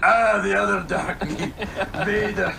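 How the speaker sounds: a hint of Fat Bastard; mock Fat Bastard. Joking Fat Bastard in Star Wars, mock Scottish. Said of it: "The fat bastard".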